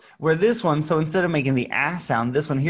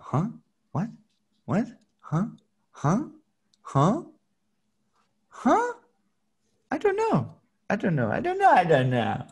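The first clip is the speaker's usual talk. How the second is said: hammed-up confusion for the class; confused person; 7 semitones higher than usual. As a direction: act lost and baffled, voice swinging up. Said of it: "That one is confused".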